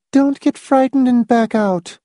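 high, level woman character voice; Miss Rice's voice. Acted. Miss Rice warning Hamilton not to back out, high character voice. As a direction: warn him in her high-pitched voice.

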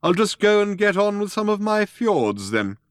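high-voiced, retreating old craftsman; taken-aback old man. A narrator's reading. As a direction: excuse yourself awkwardly, pitched high.

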